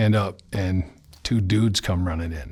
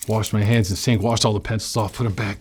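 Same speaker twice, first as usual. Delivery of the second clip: ordinary talk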